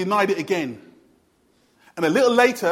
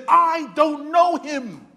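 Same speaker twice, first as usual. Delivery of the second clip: slow, higher, drawn-out denial; Peter's denial; 6 semitones higher, 3 dB louder, much slower than usual. Retold or imitated. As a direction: stretch out know like a stubborn lie. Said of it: "he's standing there and he says".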